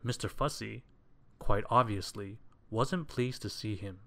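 usual speaking voice

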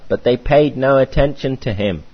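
ordinary talk, nothing marked

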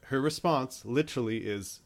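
ordinary delivery

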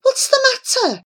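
high-pitched, anxious question; alarmed cry. A narrator's reading. Grandfather questioning the fiddling boy, alarmed high cry. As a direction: ask it in sudden alarm. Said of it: "cried Grandfather Petamiengi, alarmed".

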